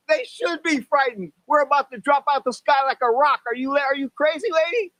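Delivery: high-pitched, acted indignant panic; panicked retort. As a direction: argue back in a freaked-out high voice.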